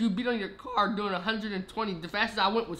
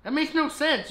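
protesting; 8 semitones higher, 5 dB louder than usual. Real, not acted. Arguing about blame, protesting.

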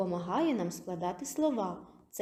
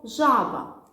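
loud demo; 3 semitones higher, 8 dB louder than usual. Shown as a demonstration. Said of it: "давайте скажемо слово".

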